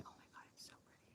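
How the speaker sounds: breathless quick whisper. Real, not acted. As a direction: whisper in awe, fast. Whispering at a butterfly.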